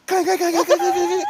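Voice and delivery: high, loud, rapid clangs; hammering high-pitched noise; imitated pot clanging. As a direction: imitate frantic banging on a lid. Imitates banging on pot lid.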